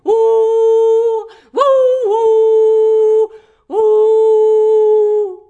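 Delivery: long, high, loud wolf howls. A narrator's reading. Wolf showing the bear its lullaby skills, howling. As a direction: howl on sustained high notes like a wolf. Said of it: "Susi antoi näytteen laulutaidoistaan ja ulvoi".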